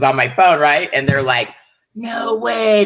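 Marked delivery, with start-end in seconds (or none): 1.9-2.8 mock amazement. Voicing the crew amazed.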